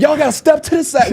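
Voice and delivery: high bossy usher voice; usher lady. Retold or imitated. Little usher lady ordering the crowd aside, high and bossy. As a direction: clear the way like a tiny bouncer.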